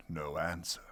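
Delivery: plain reading voice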